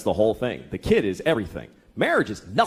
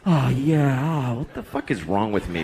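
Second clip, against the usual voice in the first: self-loathing groan. Retold or imitated.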